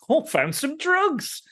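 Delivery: hamming up a giddy druggie offering pills; mock drugged-up woman.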